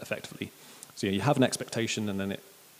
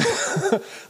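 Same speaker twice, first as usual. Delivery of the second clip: high-pitched burst of laughter; 4 dB louder than usual. Real, not acted. Preacher amused by his own impression, laughing. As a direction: crack up at your own impression.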